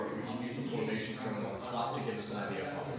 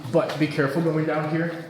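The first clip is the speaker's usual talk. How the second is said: loud warning; 6 semitones higher, 11 dB louder than usual. Real, not acted. Guide warns group on stairs.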